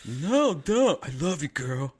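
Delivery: high whiny then crooning; cheesy lover. Retold or imitated. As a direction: play the cheesy boyfriend. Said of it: "And I'm like".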